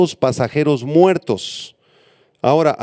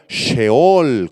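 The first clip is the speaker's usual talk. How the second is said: "Sheol" drawn out on its final syllable; stress on last syllable; 2 semitones lower than usual. Shown as a demonstration. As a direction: land the accent on the end of "Sheol". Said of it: "con acento en la A". ("Sheol" con acento en la última sílaba)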